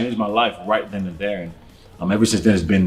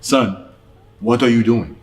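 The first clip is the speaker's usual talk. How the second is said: lightly imitating a Haitian father's accent; a hint of Haitian-accented fatherly questioning; dad's Haitian accent; 3 dB louder than usual. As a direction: quote Dad with a touch of Haitian accent. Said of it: "My dad, in his Haitian accent".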